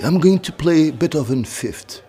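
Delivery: high, slow, affected British English; mock British accent. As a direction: put on a drawn-out, high-pitched British accent. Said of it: "il prend un accent britannique". (accent britannique imité, lent et haut perché)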